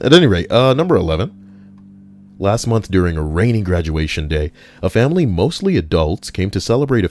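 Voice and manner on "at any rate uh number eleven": bouncy restart. Real, not acted. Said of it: "Sorry, you can't see me dancing".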